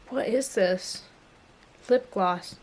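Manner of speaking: ordinary talk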